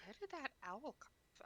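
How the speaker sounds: puzzled. Acted. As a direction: wonder quietly, a touch baffled. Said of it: "giving Tremont a puzzled look".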